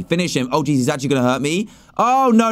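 normal talking voice

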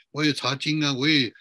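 ordinary talk

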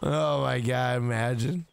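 ordinary talk

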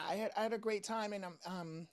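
ordinary delivery

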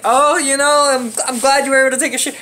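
quick, teasing mock congratulation. The family teasing him after the bathroom, mocking. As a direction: rib him with fake praise. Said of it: "They were making fun of me".

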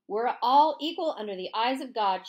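firm, loud declaration; declaring. A narrator's reading. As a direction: proclaim it firmly. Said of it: "she declared".

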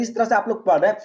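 normal talking voice

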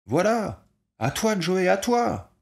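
high, loud calling out; shouting for the ball. A narrator's reading. Ball player calling to Joey for the ball, loud shout. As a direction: yell across the field for a pass. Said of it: "quatre qui criaient".

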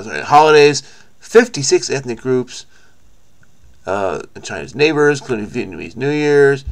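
ordinary talk

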